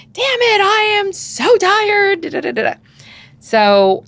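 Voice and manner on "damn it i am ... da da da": high, loud snapping parent; exasperated outburst. Retold or imitated. Parent losing it at the kids, loud exasperated snap. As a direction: blurt it out, fed up and worn out.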